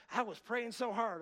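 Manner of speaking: lightly acted intense confession; earnest woman quoted. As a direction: imitate her straining sincerity. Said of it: "She said".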